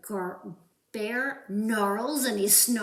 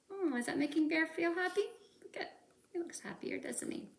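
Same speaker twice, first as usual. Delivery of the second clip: hushed aside; 6 semitones higher, 8 dB quieter, much faster than usual. Acted.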